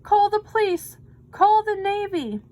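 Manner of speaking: loud high panicked calls; panicked shout. A narrator's reading. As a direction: yell for help in panic. Panicked coach.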